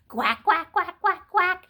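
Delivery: loud quacking like a duck. Acted. Reading to children, a loud playful duck quack. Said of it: "A duck says".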